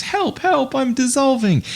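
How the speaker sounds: very high cartoon voice, mock panic; polar bear crying for help. Lecturer voicing a dissolving polar bear in a chemistry joke, very high mock panicked cry. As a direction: squeal it like a little bear melting away. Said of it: "Polar bear goes".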